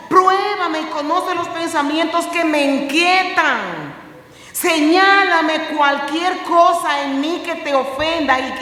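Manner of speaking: loud, high, slow cry of prayer; shouted pleading prayer; impassioned psalm prayer. Acted. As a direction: plead the psalm aloud to God. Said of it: "decir como el salmista".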